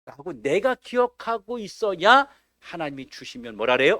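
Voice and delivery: usual speaking voice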